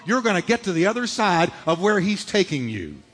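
usual speaking voice

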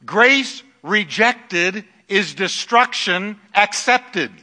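loud, high preaching cry. Acted. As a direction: preach it out with full force. Preaching at full force.

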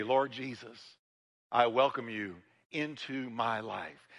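a tender welcoming prayer; quiet prayer. Acted. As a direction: pray it gently, as if surrendering. Model prayer of surrender.

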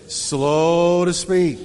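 drawn out very slowly; slow to speak. Shown as a demonstration. Pastor modeling the verse to the congregation, very slow. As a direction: stretch each word, slow and deliberate. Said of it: "Say with me".

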